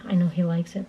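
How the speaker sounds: normal talking voice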